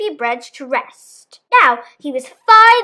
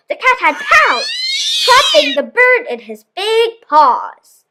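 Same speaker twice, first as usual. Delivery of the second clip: dramatic pounce; 8 semitones higher than usual. A narrator's reading. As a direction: burst out as the cat strikes.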